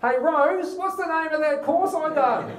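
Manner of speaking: put-on old man calling out to his wife for the answer. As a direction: call out to Rose in the next room. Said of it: "Hang on a second".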